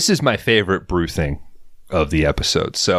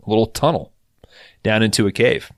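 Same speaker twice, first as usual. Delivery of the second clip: ordinary talk, nothing marked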